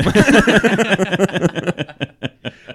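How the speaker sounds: loud burst of laughter. Real, not acted. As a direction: laugh out at the escape-room joke. Laughing at own joke.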